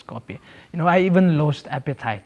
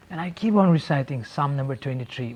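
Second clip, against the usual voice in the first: ordinary delivery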